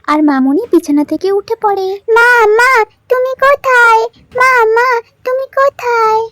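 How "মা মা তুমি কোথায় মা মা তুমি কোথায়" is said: put-on high child voice calling for mum; a small girl calling out for her mother; little girl calling mother. Little daughter calls her sick mother, high child voice.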